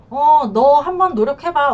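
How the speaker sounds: drawn-out, testing dare in a retold voice; mock challenge. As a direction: act out a wife daring him to try. Acted challenge from the wronged wife.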